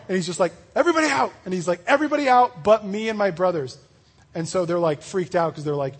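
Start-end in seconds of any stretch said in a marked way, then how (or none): 0.7-1.3 sudden high, loud command to clear the room; overwhelmed outburst. Retold or imitated. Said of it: "this overwhelms Joseph so much". 1.9-3.8 overwhelmed outburst. Retold or imitated. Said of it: "this overwhelms Joseph so much".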